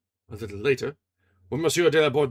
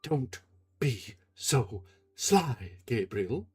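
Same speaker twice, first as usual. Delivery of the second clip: slow, softer close to the ear; low into the ear; 5 dB quieter, much slower than usual. A narrator's reading. Furious secretary speaking softly into Gabriel's ear. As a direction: lean in and murmur it. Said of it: "put his mouth to Gabriel's ear".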